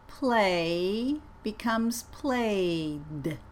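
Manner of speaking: "played" repeated very slowly with a voiced D; second very slow model of "played". Shown as a demonstration. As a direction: buzz the D in "played". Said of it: "let's practice these words".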